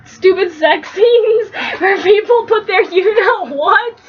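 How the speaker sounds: normal talking voice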